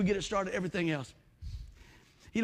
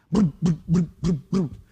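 low, loud booming imitation of a dead engine; imitated engine thuds; 5 semitones lower, 7 dB louder, slower than usual. Preacher imitating the generator failing, low loud booms. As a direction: thump out each failed pull.

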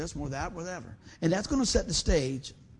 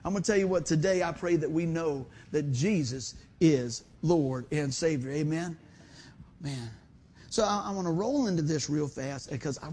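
usual speaking voice

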